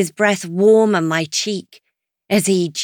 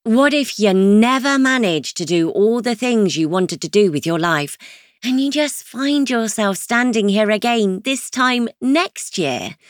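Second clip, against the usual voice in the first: ordinary delivery, nothing marked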